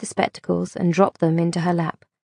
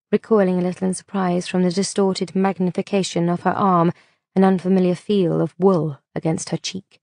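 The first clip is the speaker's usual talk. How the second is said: plain reading voice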